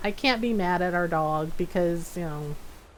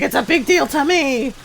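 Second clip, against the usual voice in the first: offended cat; 4 semitones higher, 4 dB louder than usual. Retold or imitated. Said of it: "He's like".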